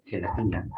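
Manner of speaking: "gelatina" starting with a throaty j sound. Shown as a demonstration. Teacher showing the j sound in "gelatina". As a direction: say "gelatina" with a j, not a hard g. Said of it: "se pronuncia como J".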